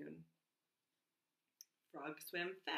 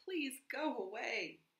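higher, mildly raised storybook plea; understated imploring call; pleading cry; 5 semitones higher, 4 dB louder than usual. A narrator's reading. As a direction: cry out to the crowd, without shouting. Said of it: "cried Frog".

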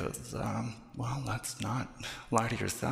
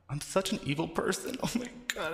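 ordinary talk, nothing marked

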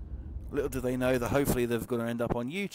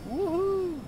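high, joyful cheer; excited whoop. Real, not acted. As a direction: whoop with delight. Celebrating in the race.

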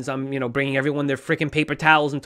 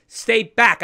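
a loud, higher shout of stay back at neighbors; retold yell; 5 semitones higher, 6 dB louder than usual. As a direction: bark it out like warning people off your lawn. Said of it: "I'm literally yelling at people to stay back".

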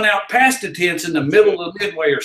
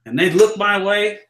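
ordinary talk

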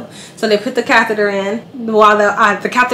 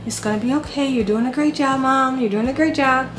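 soft, gentle repeated comfort; calm a scared mother; soothing reassurance; 3 semitones higher, 5 dB quieter than usual. Retold or imitated. Anesthesiologist calming the mother in surgery, soft soothing.

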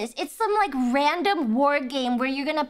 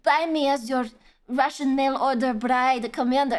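mock Russian accent, seductive ad voice; Russian voice actress; 2 semitones lower than usual. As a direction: parody a Russian actress wooing the player. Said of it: "You have the Russian voice actress".

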